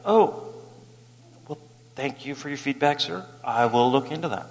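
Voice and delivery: soft, gracious reply; polite thanks. Retold or imitated. Pastor thanking elderly critic, soft and polite. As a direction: answer the insult softly and politely. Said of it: "And I was like".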